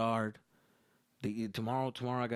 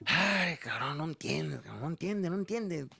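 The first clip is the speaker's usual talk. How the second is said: despairing dad; 4 semitones higher than usual. Retold or imitated. Dad complaining about his son, high and exasperated. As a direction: shake your head at a hopeless son.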